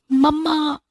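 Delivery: frantic, tearful shouting for mother; screaming for mom. Acted. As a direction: call out desperately for help. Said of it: "मैं जोर से चिल्ला रही थी".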